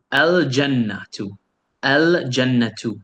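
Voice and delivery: "El jannatu" with a full, distinct L; teacher's model "El jannatu", L fully pronounced; clearly sounded L. Shown as a demonstration. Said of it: "I'm pronouncing the alif lam clearly".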